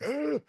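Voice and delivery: high, strangled mock groan of thirst; retold choking groan. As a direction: imitate a parched, choking ugh. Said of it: "I was like".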